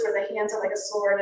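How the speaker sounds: ordinary talk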